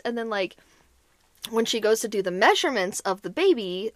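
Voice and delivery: ordinary delivery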